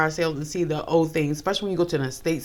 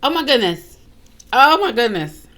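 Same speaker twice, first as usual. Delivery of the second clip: higher and louder, real astonishment; stunned; 6 semitones higher, 6 dB louder than usual. Real, not acted. Host reacting to a creepy TikTok, loudly stunned. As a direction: react as if floored by the story.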